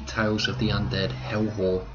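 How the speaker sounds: normal talking voice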